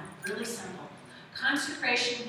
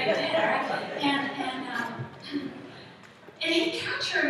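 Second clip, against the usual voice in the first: ordinary talk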